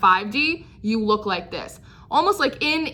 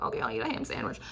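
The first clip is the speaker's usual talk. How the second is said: resigned giving in; 9 semitones lower, 11 dB quieter, faster than usual. Retold or imitated. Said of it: "I'm like".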